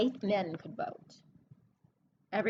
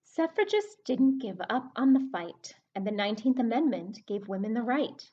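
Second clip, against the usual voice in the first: storybook reading voice; reading a rhyming book to kids; 4 dB louder than usual. Acted. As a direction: read the verse to the class, leaning on the rhyme. Teacher reads rhyming book aloud.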